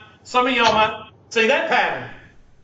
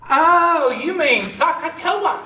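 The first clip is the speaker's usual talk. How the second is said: mock student; 7 semitones higher, slower than usual. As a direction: squeal it out like a smug student.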